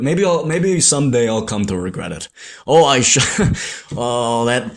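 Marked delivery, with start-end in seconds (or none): 2.7-4.7 put-on loud, slow, higher Southern accent. Older self groaning about the show, loud slow Southern accent. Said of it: "I don't know why I'm Southern".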